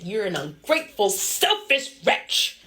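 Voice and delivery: a betrayed god's angry slow scolding; angry rebuke. Acted. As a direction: spit out each word slowly, outraged. Eros wakes angry.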